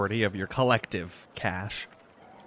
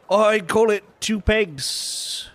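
slow price quote; 11 semitones higher, 5 dB louder, much slower than usual. Acted. Vendor names price, slow.

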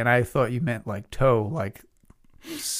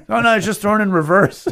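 higher, louder quoted answer; imitated offhand reply; 9 semitones higher, 8 dB louder than usual. Answering a worried bystander at the junkyard, offhand. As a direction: brush off the worry, voice raised. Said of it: "somebody being like, "Are you, everything all right?".

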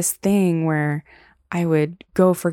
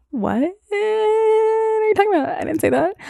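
much higher, slow, sing-song playing dumb; mock innocent denial; 3 dB louder than usual. To the boy she kissed, acting innocent in a high, slow mock voice. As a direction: feign total innocence, wide-eyed.

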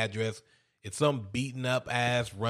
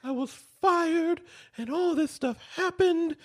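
much higher, put-on apologetic woman's voice; mock upset wife. Wife apologizing to her husband after her bad day, high mock voice.

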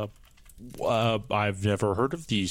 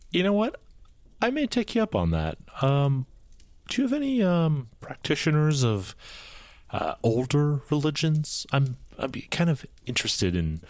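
high-pitched character voice; 6 semitones higher than usual. Acted. Tez voiced much higher.

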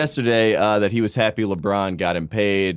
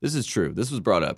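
ordinary talk

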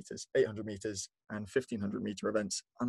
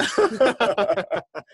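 high loud laughter; 10 semitones higher, 10 dB louder than usual. Real, not acted. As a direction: burst out laughing. Declines accent request.

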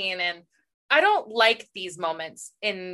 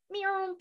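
a small high playful meow, softer; mock cat meow; 10 semitones higher, 8 dB quieter than usual. Joking to her co-host after the quote, high soft meow. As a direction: squeak out a silly little cat meow.

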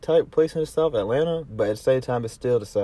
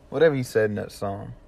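ordinary delivery

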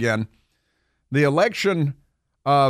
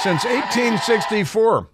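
incredulous echo; 7 semitones higher than usual. Retold or imitated. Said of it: "incredulous echo".

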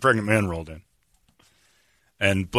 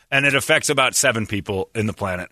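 ordinary talk

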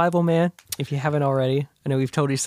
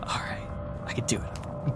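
much softer, unvoiced resolve; relieved whisper; 9 dB quieter than usual. Derek's relieved inner whisper.

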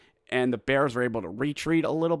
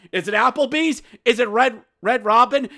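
ordinary talk, nothing marked

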